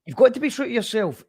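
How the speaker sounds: emphatic encouragement. Real, not acted. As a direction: push the point home with conviction. Host insisting on self-belief.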